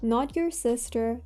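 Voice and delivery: usual speaking voice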